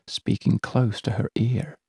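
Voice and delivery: ordinary delivery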